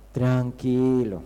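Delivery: low, very slow soothing word; calming pastor. Retold or imitated. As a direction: draw it out slowly to settle someone's nerves. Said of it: "¿Qué le dice?".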